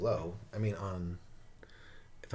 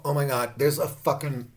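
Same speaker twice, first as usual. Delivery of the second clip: usual speaking voice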